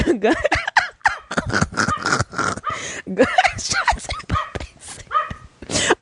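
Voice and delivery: laughing through the words, high and stretched out; real giggle carrying the title, drawn out slowly. Real, not acted. Host cracking up while repeating the episode title, laughing.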